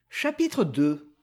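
ordinary delivery

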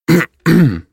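sharp, high throat clear. Acted. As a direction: clear the throat before speaking. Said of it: "Прокашлялся незнакомый голос".

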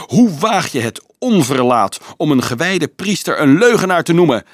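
high, loud outraged screech. A narrator's reading. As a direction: screech in indignant fury. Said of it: "krijste Nicolaas plotseling".

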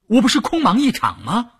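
high-pitched, dismayed “空忙一场”; startled protest. A narrator's reading. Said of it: "吓了一跳". (高声惊慌地抱怨)

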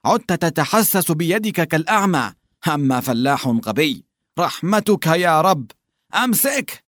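high, exasperated nagging; scolding hunchback. A narrator's reading. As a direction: scold the clumsy partner. Lubim scolds Gerasim. (أحدب يوبّخ زميله بضيق)

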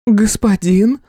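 high startled woman. A narrator's reading. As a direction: gasp the word out. Startled recognition.

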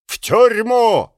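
barked order. Acted. Crowd shouting orders.

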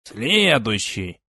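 slow, firm examiner call; a hint of stern teacher calling out; firm announcement. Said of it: "твёрдым голосом объявил".

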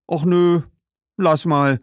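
hoch und laut, abwinkend; ablehnend. Acted. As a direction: lehnt dankend, aber bestimmt ab. Lehnen Fischsoße ab. (die Kinder lehnen die Fischsoße ab, abwinkend)

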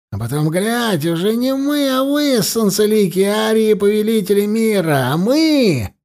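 high-pitched indignant outpouring; bitter, mocking high rant; unrestrained indignation. A narrator's reading. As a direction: let the grievance surge upward. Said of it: "он больше не пытался сдержаться".

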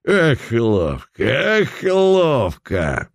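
put-on rowdy drunk whoop; drunken reveler.

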